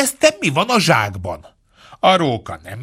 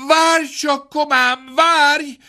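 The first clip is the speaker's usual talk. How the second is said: higher, calling through the door to wait; 13 semitones higher, slower than usual. A narrator's reading. Poor man calling to the fox through the door. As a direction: call out to hold him off. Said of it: "kiáltotta a szegény ember".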